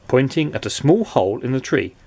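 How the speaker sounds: ordinary delivery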